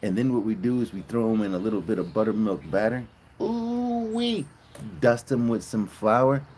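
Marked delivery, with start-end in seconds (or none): 3.4-4.5 squeal. Real, not acted. Sudden high squeal mid-recipe.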